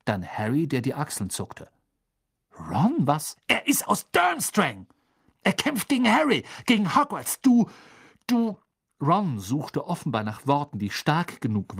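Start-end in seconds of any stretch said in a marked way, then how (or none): 2.7-8.6 high-pitched, heated accusation; indignant outburst. Acted. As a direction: splutter it out, hunting for words. Heated quarrel, Ron accuses Hermine.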